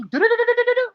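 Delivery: fast, high-pitched run of doo-doos; imitated rushing noise. Mimics a Karen rushing in.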